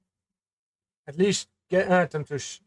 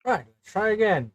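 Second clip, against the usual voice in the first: calling out; 6 semitones higher, 8 dB louder than usual. Acted. Calling everyone to stop.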